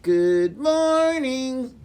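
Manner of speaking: high, slow drawn-out puppet voice; Turtle puppet. Acted. Turtle puppet greeting the class, high slow voice. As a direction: greet the kids as a shy turtle. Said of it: "This is Turtle".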